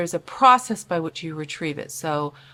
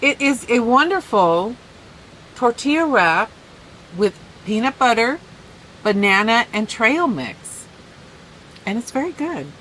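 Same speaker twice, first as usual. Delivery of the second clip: excited; 5 semitones higher, 5 dB louder than usual. Real, not acted. Enthusiastic praise of her wrap.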